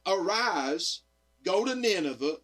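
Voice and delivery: ordinary delivery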